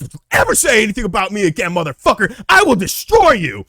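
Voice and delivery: loud, high, rushed threat building to a yell; furious-sounding mock rant, peaking at the end; re-enacted angry threat. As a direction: shout it like mid-stomp. Re-enacting threat while stomping the kid.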